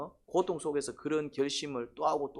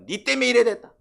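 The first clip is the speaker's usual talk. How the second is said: acted blaming outburst, high and loud; loud, high-pitched mock accusation; resentful complaint; 7 semitones higher, 8 dB louder than usual. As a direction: act out lashing out at someone, raised voice. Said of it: "고통 중에 불평이 나와요".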